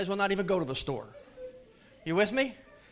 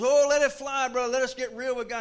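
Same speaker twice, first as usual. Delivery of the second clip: higher, louder amen-corner shout; fervent call-back; 5 semitones higher, 5 dB louder than usual. Retold or imitated. A church crowd answers the revival preacher with a louder, higher, fervent shout. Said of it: "He goes".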